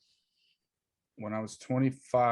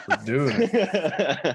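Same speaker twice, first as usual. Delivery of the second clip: laughing; 7 semitones higher, 4 dB louder, much slower than usual. Retold or imitated. Retold agreeing with wife laughing.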